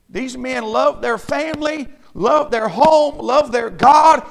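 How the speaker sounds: fervent preaching. Real, not acted. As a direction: preach it with rising force, stretching each beat. Preacher builds to a fervent peak.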